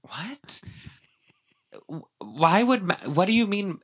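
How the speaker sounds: high-pitched, baffled questioning; mock bafflement. Host acting out her reaction to the scene, mock bafflement.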